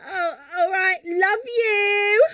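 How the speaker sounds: high mock-forlorn farewell; Ashley. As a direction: give in sweetly, stretching the goodbye. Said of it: "I'll be Ashley".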